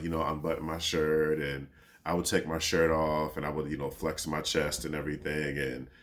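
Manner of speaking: ordinary talk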